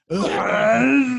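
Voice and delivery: loud, high drawn-out zombie groan; open-throated undead moan. Acted. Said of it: "just rotting and moaning".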